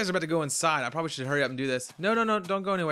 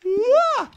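startled yelp; 10 dB louder than usual. Real, not acted. Startled by something in the game.

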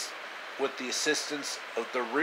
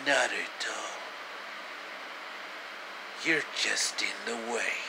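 low, soft menacing villain voice; lightly voiced creepy villain, low and hushed; sinister villain; 5 semitones lower, 10 dB quieter than usual. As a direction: purr it like a scheming snake. Villain Orochimaru speaking to Sasuke.

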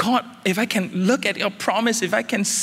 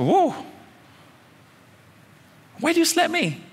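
much higher, hammed-up indignant whoa; mock shocked; 11 semitones higher than usual. Pastor asking the friend why he slapped him, mock shock. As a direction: act stunned at the pretend slap. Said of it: "I say".

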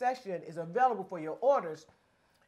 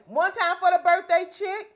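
hype cheer; 8 semitones higher, 9 dB louder, slower than usual. Real, not acted. Birthday toast with croissants.

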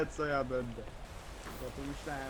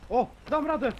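excited shout; 8 semitones higher, 6 dB louder than usual. Real, not acted. Climbing, straining to make it.

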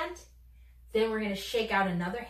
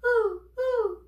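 higher, louder short hoots; owl hoot; 11 semitones higher, 6 dB louder than usual. Retold or imitated. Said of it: "an owl goes".

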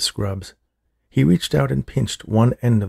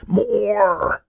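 put-on high, strained scream of an old man; 12 semitones higher than usual. A narrator's reading. Old man screaming at the orderly, voice getting weaker. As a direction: shriek it as the voice starts to fail. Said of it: "Old Man Finster screamed".

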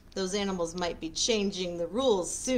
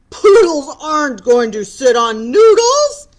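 louder, shocked cry of protest; shocked outcry; 8 semitones higher, 16 dB louder than usual. Acted. The dog protests to the frog, loud shocked outcry. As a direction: cry out in disbelief. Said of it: "gasped the dog".

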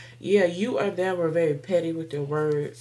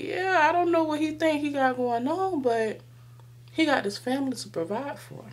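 higher-pitched exasperated onlooker, lightly acted; lightly voiced angel; 9 semitones higher than usual. Angel remarking on the man with the bills, lightly voiced and higher. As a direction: lift the pitch for an angel's aside. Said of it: "the angel's looking like".

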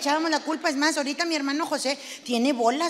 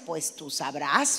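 stern mother; 11 semitones lower, slower than usual. Retold or imitated. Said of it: "Y mi mamá me decía".

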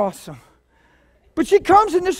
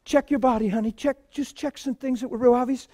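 softer, tender request; gentle coaxing; 2 semitones lower, 9 dB quieter, faster than usual. Retold or imitated. Preacher gently asking Tammy to check her body, soft. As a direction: coax her gently, like a carer. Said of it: "So we pray for her".

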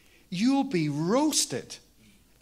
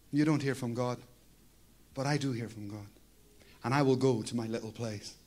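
ordinary talk

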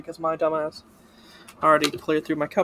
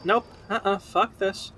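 ordinary talk

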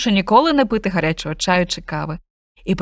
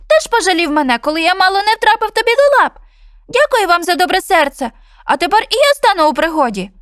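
much higher, louder bird voice; chirpy bullfinch; 9 semitones higher, 6 dB louder than usual. Acted. Bullfinch urges cat and dog to follow, high bird voice. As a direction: chirp it urgently.

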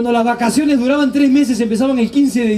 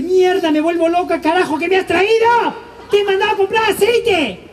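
hammed-up mom losing her mind over the wrong groceries; exasperated mom; 6 semitones higher than usual. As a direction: scold like a fed-up mother. Exasperated mother scolding son.

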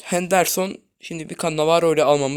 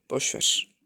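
whispered shrug; 4 semitones lower than usual. Real, not acted. Whispered resignation.

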